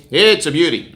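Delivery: "It's a beauty" with a mock-proud Canadian lilt; Canadian accent. Shown as a demonstration. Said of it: "making fun of my accent".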